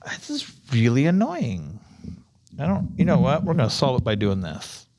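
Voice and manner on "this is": whispering. Real, not acted. Muttering while fixing the device.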